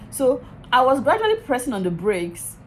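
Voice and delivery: ordinary talk, nothing marked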